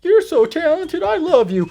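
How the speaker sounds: high-pitched mock adoration; mock gushing. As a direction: gush in an exaggerated high voice. Mocking retold gushing movie dialogue.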